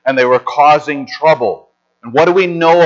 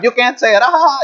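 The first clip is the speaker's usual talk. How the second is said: much higher, imitating her laughing taunt; mock teasing laugh; 13 semitones higher than usual. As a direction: mimic a laughing friend poking fun, voice shooting up. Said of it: "She would laugh".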